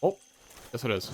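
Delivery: ordinary delivery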